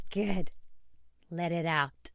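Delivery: the good repeated quietly, soothing a client. Retold or imitated. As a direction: ease off into a gentle reassurance. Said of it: "my response to her today was".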